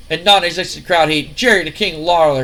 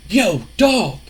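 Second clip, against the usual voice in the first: street slang greeting; 5 semitones higher than usual. Retold or imitated. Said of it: "and he goes".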